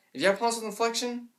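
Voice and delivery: ordinary talk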